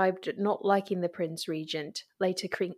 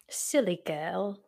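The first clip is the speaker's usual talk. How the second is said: mock teasing; 4 dB quieter, slower than usual. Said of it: "Oh, how silly of me".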